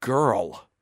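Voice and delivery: loud, rising cry of shock; cries out. Acted. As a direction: blurt it out, astonished. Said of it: "he cries".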